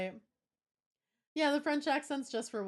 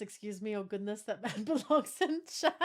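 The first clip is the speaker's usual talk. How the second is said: usual speaking voice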